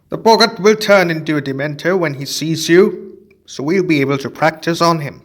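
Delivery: a cheerful professor showing his find; Lupin explaining. Acted.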